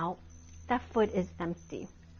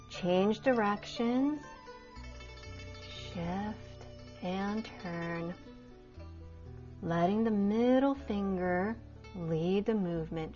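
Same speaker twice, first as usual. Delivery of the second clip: serene tai chi cues; 5 dB quieter than usual. Acted. Guiding flowing movement practice.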